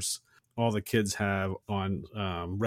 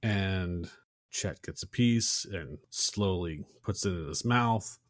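ordinary delivery

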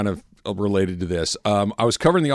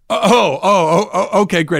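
higher, louder stammered acceptance; flustered acted reply; 8 semitones higher, 6 dB louder than usual. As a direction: act out being thrown by a brush-off. Retelling his flustered reply.

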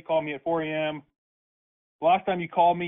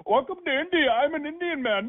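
high mocking fake Indian accent, much higher than his own voice; 9 semitones higher than usual. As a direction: sneer it out in a put-on Indian accent to ridicule the caller. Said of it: "This is how you sound right now".

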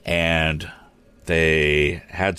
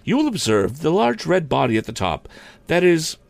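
high-pitched lady teacher's lecture voice; lecturing teacher; 10 semitones higher than usual. Acted. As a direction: begin the lesson in a high teacherly voice. Miss Delafield lecturing.

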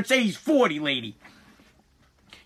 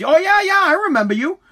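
mock recognition; 7 semitones higher than usual. Dispatcher feigning delight at celebrity caller.

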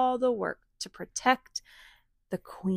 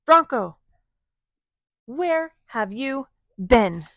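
calling out; 5 semitones higher, 6 dB louder than usual. Acted. Friends calling out to Bronco.

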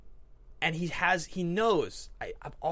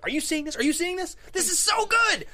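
high, excited cry rising to a shout; thrilled exclaiming; 6 dB louder than usual. Acted. Viewer sharing a fist pump moment, high-pitched excitement. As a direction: gush loudly at a buddy, building as you go.

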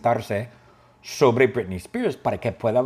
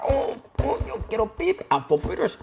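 whiny cry; 11 semitones higher than usual. Acted.

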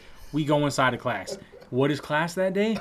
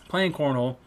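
ordinary talk

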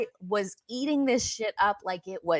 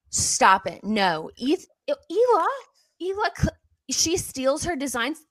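shocked protest; 6 semitones higher than usual. Retold or imitated. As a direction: gasp then high alarmed protest. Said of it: "So I was like".

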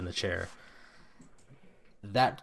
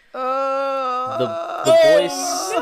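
shriek; 10 dB louder, much slower than usual. Acted. Demon possession, sudden shriek.

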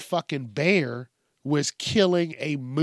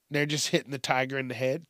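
ordinary talk, nothing marked

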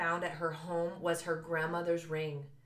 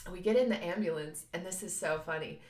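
ordinary talk

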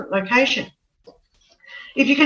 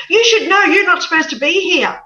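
fast, louder, higher scolding; angry scolding; 7 semitones higher, 5 dB louder, faster than usual. Retold or imitated. Angry great grandmother scolding the lost visitor. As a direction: scold her sharply. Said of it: "she was extremely angry".

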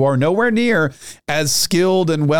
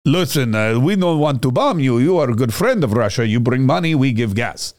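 mock Russian accent; 2 semitones lower than usual. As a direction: play a sweet-talking Russian diplomat in a mock accent. Russians wooing the world, retold.

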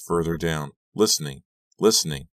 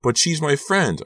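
high, loud protest; frantic raised cry; screamed; 11 semitones higher, 6 dB louder than usual. A narrator's reading. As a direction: scream it in desperation. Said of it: "I screamed".

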